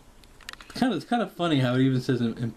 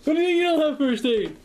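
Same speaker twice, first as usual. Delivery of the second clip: high, loud disbelieving shout; indignant mock shout, much higher; mock outrage; 6 dB louder than usual. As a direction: yell at the crew in hammed-up disbelief. Imagined outraged reaction.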